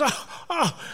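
high, choked mock cry; retold crying oh. Angel overcome, gasping at the promotion, tearful. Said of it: "she starts to cry".

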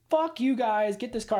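retold furious cop yelling; mock angry cop. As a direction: chew out the towing guys. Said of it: "she is like".

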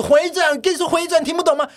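over-the-top high yelled nag; shrieking demand. Retold or imitated. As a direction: screech the order from the back seat. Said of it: "后面女生就说".

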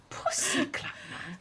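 put-on soft, breathy Jamaican accent echo; quietly mimicking a Jamaican accent; Jamaican accent impression. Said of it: "impersonate this Jamaican accent".

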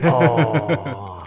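disappointed oh through a laugh; deflated laugh. Retold or imitated. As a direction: let the hope drain out, laughing. Learns she has a boyfriend.

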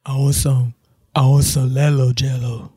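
"Lelo" with L for the Y of yellow, low kid impression; low-pitched imitation, saying "Lelo" for yellow; brother's speech impediment. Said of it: "He struggled with Y".

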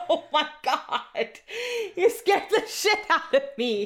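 high, breathless laughing after a fright; shaken laughter. Real, not acted. To her husband who just scared her, high shaken laughter. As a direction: laugh off the scare, still rattled.

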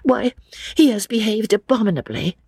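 high-pitched outrage at normal volume; indignant. Acted. Lucy condemns George to his father, indignantly.

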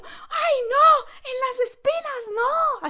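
high, loud mock scream; screaming pilot. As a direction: shriek it like he did. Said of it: "Así gritó".

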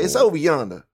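thick, put-on Southern "over yonder"; Southern accent. Shown as a demonstration. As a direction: show how a Southerner says it. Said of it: "say it like a Southerner".

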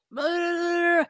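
high, loud mocking rant; mock screaming. Enraged caller insulting her podcast, hammed-up screaming. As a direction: imitate a raging caller, drawing out the final insult. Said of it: "he's screaming all these things to me".

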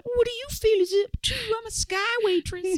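high, fast, bubbly flight attendant voice; put-on woman's voice. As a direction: play a chirpy sky waitress. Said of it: "I feel like I'm doing".

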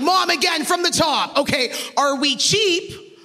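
high-pitched mock yell at mom; half-joking high shout. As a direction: daughter mock-yelling at her mom each morning. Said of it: "screaming at my mom".

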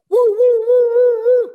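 very high, loud whooping siren; imitated police siren. Storyteller mimicking police alarms out at sea, loud high whoops. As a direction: wail out woo-woo like an alarm. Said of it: "police alarms".

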